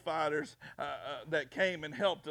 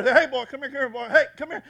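loud, high retold holler; calling him over; 7 semitones higher, 10 dB louder, faster than usual. Preacher calling an angry bullfighter over, retold holler. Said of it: "I called him over".

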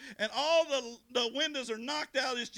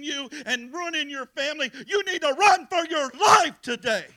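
loud, urgent exhortation building to a shout; full-force preaching; 3 semitones higher, 8 dB louder than usual. Real, not acted. Preacher urges listeners to run from addiction, full-force preaching. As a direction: preach it hard, driving to a shouted climax.

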